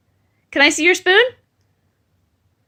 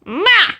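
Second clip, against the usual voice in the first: blown kiss; 9 semitones higher than usual. Shown as a demonstration. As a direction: show a kiss sound. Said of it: "Blow kisses".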